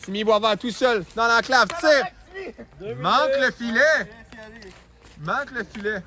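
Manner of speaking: quick hockey call; play-by-play. Real, not acted. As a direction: call each shot live.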